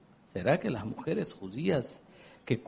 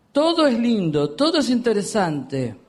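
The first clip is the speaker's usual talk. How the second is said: raised, ironic; 8 semitones higher, 6 dB louder than usual. Acted. Sarcastic preaching build-up.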